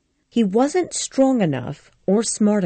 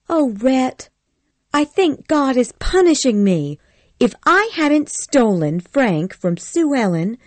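put-on higher, pleading young woman's voice; upset Scarlett; 7 semitones higher than usual. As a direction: Scarlett fretting guiltily to Rhett, higher.

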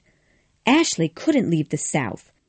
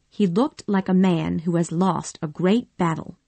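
ordinary delivery

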